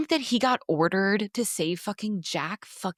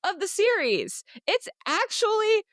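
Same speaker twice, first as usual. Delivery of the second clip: outraged realization; 10 semitones higher than usual. Retold or imitated. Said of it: "to me, I'm like".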